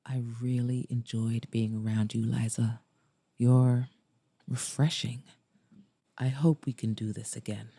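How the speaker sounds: low, softened male character voice; sincere. A narrator's reading. Maxwell to Liza as he drops her off, sincere low voice. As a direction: drop the pitch and confess it sincerely. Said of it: "caught off guard by his sincerity".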